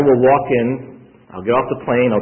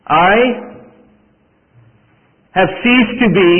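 higher, louder, slow and insistent; forceful third take; 7 semitones higher, 5 dB louder, slower than usual. Shown as a demonstration. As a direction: preach it out slow and strong. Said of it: "Let me say it a third way".

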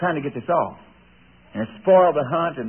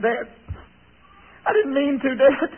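boy pleading through mock tears, higher; a lightly voiced tearful boy begging his dad; retold crying; 8 semitones higher than usual. Son begs his father while crying, mock tearful. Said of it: "I'm crying".

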